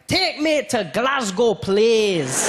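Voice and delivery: put-on high, slow, overdone Scottish accent; Scottish accent attempt. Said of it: "I tried to learn the accent".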